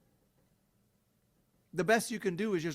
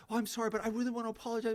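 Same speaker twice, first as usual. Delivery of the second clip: mock eager apology; 7 semitones higher, much faster than usual.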